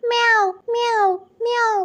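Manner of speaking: drawn-out mimicked meows, one after another; imitated cat meow. Shown as a demonstration. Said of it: "പൂച്ച കരയുന്ന ശബ്ദം".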